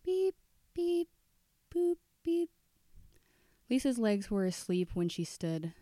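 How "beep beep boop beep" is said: very high sung dial beeps; imitated phone tones. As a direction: chime the keypad tones like a phone. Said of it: "her mind played the tones back".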